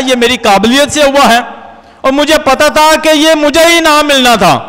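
normal talking voice

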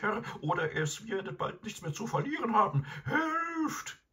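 very high, frantic wailing plea; high, urgent dog voice stretching into howls; howling dog. A narrator's reading. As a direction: howl the alarm like a desperate hound. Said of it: "Garm begann zu heulen".

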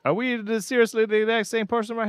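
high, incredulous questions; surprised. Real, not acted. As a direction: disbelief at the lookalike. Startled at lookalike character.

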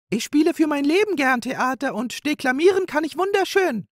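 fluttering with eagerness; excited magpie. Acted. Magpie hopping about asking how many roles, excited.

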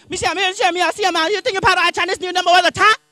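high, loud mock Chinese lady; Chinese accent. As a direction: shout it in a put-on Chinese accent. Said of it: "she says something".

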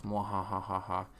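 gloating mwahaha in an odd voice; Orochi's smug evil laugh; villain laugh. Acted. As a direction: cackle like a gloating tyrant. Orochi gloats over Yasu's death.

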